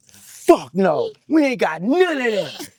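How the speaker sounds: high and pumped, laughing off the question; loud cocky boast; hyped brag. Retold or imitated. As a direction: swagger it out, rising fast. Retelling his hyped answer about permit.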